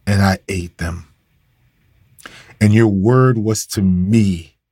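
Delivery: slow, measured reading of the verse; scripture reading. Acted. Preacher reading the verse to his listeners, slow and measured. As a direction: let each phrase of the verse land slowly.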